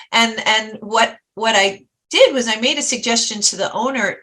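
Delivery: ordinary talk, nothing marked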